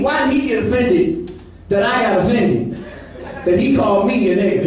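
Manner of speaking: normal talking voice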